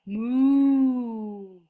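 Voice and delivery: long mooing like a cow. Acted. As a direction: moo like a cow. Animal sound quiz.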